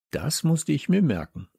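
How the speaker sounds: ordinary delivery, nothing marked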